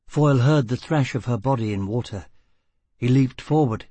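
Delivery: usual speaking voice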